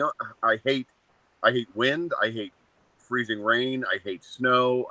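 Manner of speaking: normal talking voice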